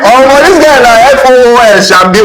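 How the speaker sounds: very high, loud pidgin hailing with a laugh; laughing Naija banter. Retold or imitated. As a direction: rib your friend, cracking up. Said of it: "when you greet".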